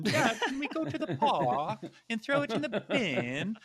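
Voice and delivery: a little boy imitated in a high, laughing British accent; laughing as you mimic a child's British accent; British kid accent. Dad imitating his son's British school accent, laughing. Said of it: "And he had an accent".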